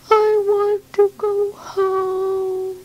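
high, very slow and plaintive; a little one on the edge of tears; sad baby bird. Acted. Homesick baby bird longs for its mother, high and slow.

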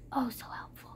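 whispered sarcasm under the breath; a bitter aside whispered to nobody; sarcastic whisper. Real, not acted. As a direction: hiss "so helpful" to yourself, dry.

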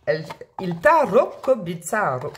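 slow, playful Italian accent. Shown as a demonstration. Tarot reader announcing deck number three, Italian accent. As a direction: draw out the deck's name in an Italian accent. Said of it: "Avec les accents".

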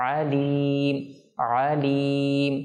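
আলিম opened from the throat, long "ee"; correct ayn. Shown as a demonstration. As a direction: squeeze the first sound of আলিম in the throat. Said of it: "আইন যেন ঠিকমতো উচ্চারণ হয়".

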